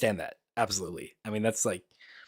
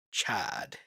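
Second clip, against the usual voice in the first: breathy awed whisper of one word; whispered Chad; 4 semitones lower, 8 dB quieter than usual. Retold or imitated. Whispering Chad about the cool main character. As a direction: whisper it like a reverent aside. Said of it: "I'm like".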